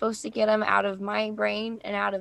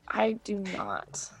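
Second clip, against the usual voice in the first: ordinary talk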